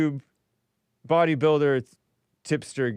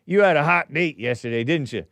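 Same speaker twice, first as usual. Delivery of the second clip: usual speaking voice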